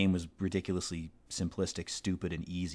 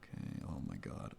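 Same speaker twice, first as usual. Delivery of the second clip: whispered, much softer oh my god; whispered exasperation; 11 semitones lower, 12 dB quieter than usual. Real, not acted. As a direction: sigh it out under your breath. Exasperated aside under breath.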